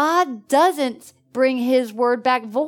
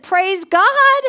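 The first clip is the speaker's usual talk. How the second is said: higher and louder, exulting; joyful praise; 9 semitones higher, 7 dB louder than usual. Retold or imitated. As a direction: burst out with praise. Meeting the man at door.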